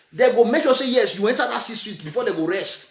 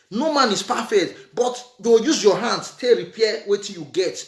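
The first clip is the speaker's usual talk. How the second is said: usual speaking voice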